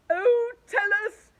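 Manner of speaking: high, loud, slow eager cry; cried. A narrator's reading. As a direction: plead to hear the plan. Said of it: "cried Earthworm".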